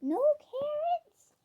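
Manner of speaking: very high, loud, drawn-out question; incredulous pet talk. Real, not acted. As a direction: squeal in disbelief at a fussy bunny. Pet rabbit refuses the carrots.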